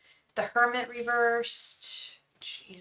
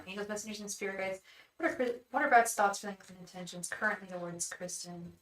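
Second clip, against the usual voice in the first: soft, hushed chanting to the spirit guides; whispered invocation; 7 dB quieter, faster than usual. Acted. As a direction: murmur the question over and over like a prayer. Tarot invocation repeated softly.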